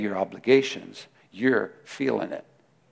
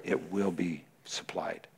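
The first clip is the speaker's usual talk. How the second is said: whisper; 3 semitones lower, 9 dB quieter, slower than usual. Real, not acted. Sermon point hushed.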